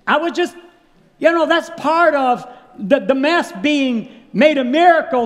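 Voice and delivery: high, loud, emphatic sermon delivery; impassioned preaching. Real, not acted. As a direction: press the lesson home to the congregation.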